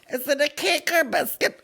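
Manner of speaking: very high, loud silly voice; hammed-up, high-pitched pestering; mock questioner. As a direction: squeal the question in mockery. Mocking a silly podcast question.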